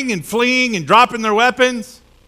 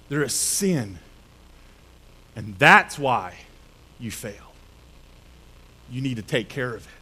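much softer, low and grave; quiet verdict; 8 semitones lower, 7 dB quieter than usual. Retold or imitated. God quietly telling Joshua the cause, soft grave verdict. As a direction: drop to a hush to land it.

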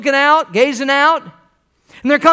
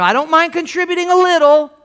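higher-pitched mimicry of a reluctant giver; half-committed churchgoer; 6 semitones higher than usual. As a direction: lightly mimic someone who will only go so far. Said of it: "when I hear that kind of thing".

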